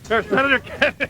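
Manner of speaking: calling out. Real, not acted. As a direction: call out to him as he walks off. Reporter chasing senator down street.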